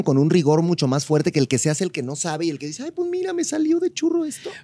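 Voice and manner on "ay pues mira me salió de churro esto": much higher, softer mock-innocent voice; mocking falsetto. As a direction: play dumb in a silly high voice. Mocking the one who pretends. (voz burlona muy aguda de falsa inocencia)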